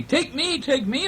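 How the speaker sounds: very high squeaky begging; puppy begging the boy to choose it, squeaky and eager. Acted. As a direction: plead like an excited little puppy. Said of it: "I barked".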